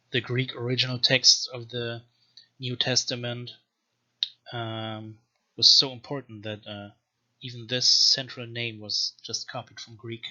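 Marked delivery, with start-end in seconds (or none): none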